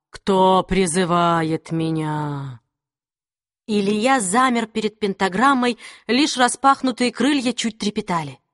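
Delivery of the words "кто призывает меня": low, slow demon voice; slow menacing demon from the void; demonic whisper. Acted. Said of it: "Голос демона напоминал треск разбитого стекла". (низкий медленный голос демона)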